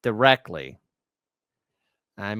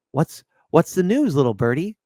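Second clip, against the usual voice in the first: ordinary delivery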